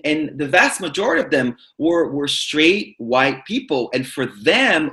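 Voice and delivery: normal talking voice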